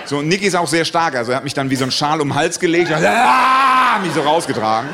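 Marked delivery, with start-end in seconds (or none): 2.9-4.0 long, loud acted war cry; drawn-out heroic roar; battle yell. Friend hauling his comrade out, mock battle yell. Friend hoisting and carrying him out.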